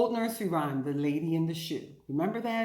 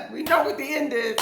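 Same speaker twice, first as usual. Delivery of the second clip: laughing, higher and louder; 8 semitones higher, 5 dB louder than usual. Real, not acted. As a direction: chuckle knowingly. Laughing at the ending.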